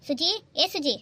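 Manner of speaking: loud call across the house. Acted. Husband calling his wife Suji from outside, loud call.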